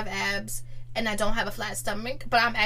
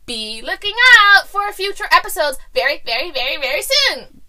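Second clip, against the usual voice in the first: imitating Bela; 5 semitones higher, 9 dB louder than usual. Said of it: "you sound like Bela".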